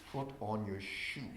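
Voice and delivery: slow, low, heavy instruction; mock calm order. Staff member claiming calm to a client, slow low order. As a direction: drag out a low, slow command. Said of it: "I, I, like this".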